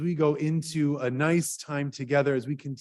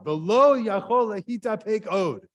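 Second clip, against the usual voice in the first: slow, raised mock shout of an angry student; retold shout; 6 semitones higher, 5 dB louder than usual. Student yelling at his old teacher, mock shout. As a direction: call it out slowly as the heckler would. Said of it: "he shouted at David Hartman".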